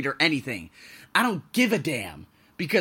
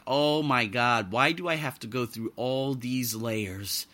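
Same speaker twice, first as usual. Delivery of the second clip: exasperated groan; 5 semitones lower, 5 dB quieter than usual. Retold or imitated. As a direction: drag it out like you're fed up with paperwork. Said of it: "where you're like".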